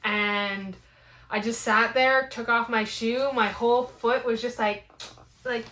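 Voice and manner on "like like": normal talking voice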